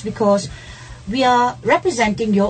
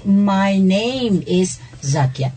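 very slow, measured model sentence at an even pitch; slow correct model; much slower than usual. Shown as a demonstration. Teacher telling students what to say instead, slow and measured. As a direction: give the correct sentence word by word. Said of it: "You say".

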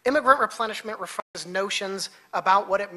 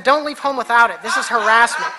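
louder, higher sarcastic punchline with a laugh; loud mocking quip; 4 semitones higher, 9 dB louder than usual. Teacher mocking the agent with his green card, loud laughing protest. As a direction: throw the slogan at him, then protest.

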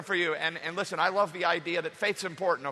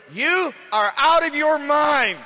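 slow, much higher, louder mocking retort; scoffing disbelief; 9 semitones higher, 10 dB louder, much slower than usual. The prayer meeting scoffs at Rhoda, slow mockery. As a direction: drag it out in disbelief. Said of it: "They said to her".

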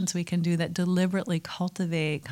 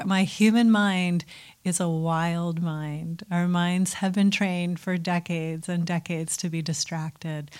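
ordinary talk

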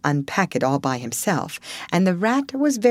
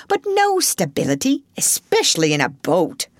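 ordinary delivery

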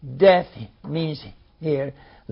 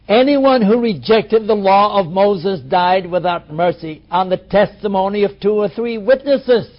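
firm, louder Bible reading; scripture reading; 3 semitones higher, 5 dB louder than usual. Acted. Preacher reading Hebrews aloud to his class, firm. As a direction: proclaim the law of Moses.

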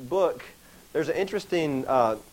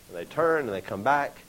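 normal talking voice

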